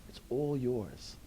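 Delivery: usual speaking voice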